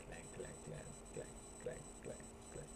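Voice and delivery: whispered clank clank fading away; pot clanking noise. Retold or imitated. Imitating pot falling down stairs.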